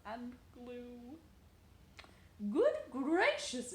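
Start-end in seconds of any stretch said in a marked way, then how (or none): 2.5-3.7 high, loud, slow gasp of delight; a mother amazed by her toddler's tower; exclaiming mother. A narrator's reading. Mother amazed by the tower, exclaiming loudly. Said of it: "his mother exclaimed".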